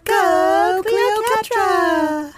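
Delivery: loud, high, drawn-out cheer; cheering shout. Acted. Kid cheers for Cleocatra, loud and slow. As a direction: stretch Cleocatra out like a chant from the stands.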